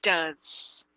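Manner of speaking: DOES said duz, with a short uh vowel; changed vowel. Shown as a demonstration. Teacher showing the exception, DOES pronounced duz. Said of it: "aqui muda um pouco".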